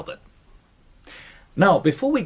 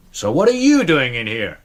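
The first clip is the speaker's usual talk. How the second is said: a hint of a gruff colonel, louder; lightly voiced angry colonel; Colonel Grace; 6 dB louder than usual. Colonel Grace confronting the butler in his library, mock anger. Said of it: "imagine I'm Colonel Grace".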